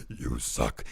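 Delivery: very low, slow demonic taunt; soft, menacing bass growl from inside the head; deep, horrible voice. Acted. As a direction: drop way down like an evil inner voice. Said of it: "that deep, horrible".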